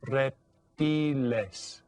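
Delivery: "Reptiles" very slowly as rep-TEE-les; Spanish vowels. Shown as a demonstration. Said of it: "vowel sounds are always pronounced the same".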